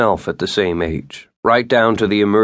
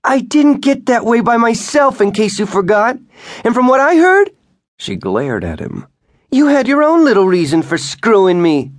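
much higher and louder accusing scream; wife screaming at her husband during the fight; furious shout; 6 dB louder than usual. A narrator's reading. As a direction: fling it in his face.

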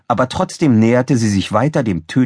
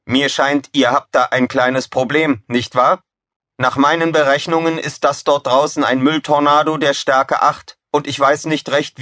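higher-pitched, evenly intoned computer; cheerful machine voice; 6 semitones higher than usual. A narrator's reading. Computer briefs crew on survival odds, cheerful machine voice. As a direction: deliver grim odds like a chirpy computer. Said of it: "eine fröhliche Maschinenstimme".

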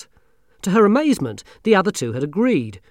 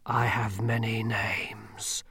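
grim reaper voice; 5 dB quieter, much slower than usual. Acted. Death speaks.

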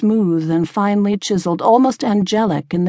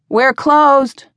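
raised, higher voice calling across the bar; 8 semitones higher, 5 dB louder than usual. A narrator's reading. Tavern keeper to a late stranger, calling out. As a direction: call it out firmly to a newcomer. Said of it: "Emma called from behind".

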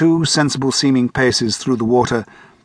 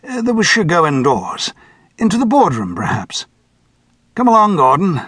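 higher, gently commanding chairman; 6 semitones higher than usual. A narrator's reading. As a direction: coax Gordon indoors with polite authority. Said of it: "the chairman said".

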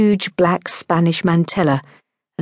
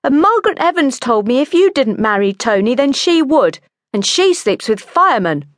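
high, insistent, complaining voice; pitched-up petulant tattling; whining; 9 semitones higher, 3 dB louder than usual. A narrator's reading. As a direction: whine on like an aggrieved sister. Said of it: "whined her sister".

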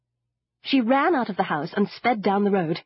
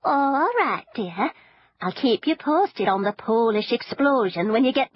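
granny voice; 4 semitones higher than usual. A narrator's reading. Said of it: "promised Granny".

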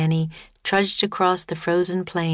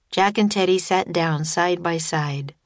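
plain reading voice

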